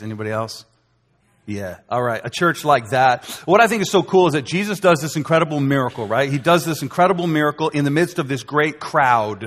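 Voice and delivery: ordinary talk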